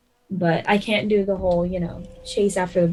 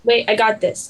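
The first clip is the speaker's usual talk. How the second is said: ordinary talk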